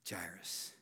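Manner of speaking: breathy whisper of the name; soft unvoiced Jairus. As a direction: whisper it with mock pity. Doubters voiced, whispered name.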